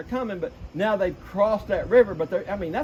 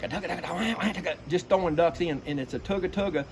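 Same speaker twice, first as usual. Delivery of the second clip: rolling feed call. Shown as a demonstration. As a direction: mimic distant ducks feeding. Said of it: "what I call a refuge feed or a rolling feed".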